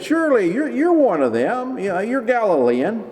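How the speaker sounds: high, pressing accusation; suspicious bystanders. Retold or imitated. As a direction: corner him with the suspicion.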